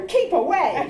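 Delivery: laughing mock holler. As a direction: call it out playfully across the dark.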